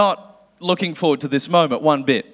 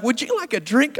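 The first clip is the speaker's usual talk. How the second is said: polite flight attendant; 6 semitones higher than usual. Retold or imitated. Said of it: "they're like".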